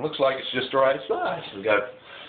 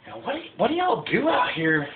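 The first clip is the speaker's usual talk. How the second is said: new sailor; 11 semitones higher than usual. Retold or imitated. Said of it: "and he's like".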